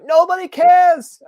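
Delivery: very high, loud, drawn-out yell; yelled-out heckle. Retold or imitated. New Yorker shooting down a happy man, imitated yell. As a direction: holler it slowly across the street. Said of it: "someone yells out".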